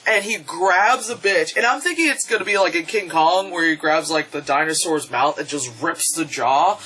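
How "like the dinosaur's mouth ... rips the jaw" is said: normal talking voice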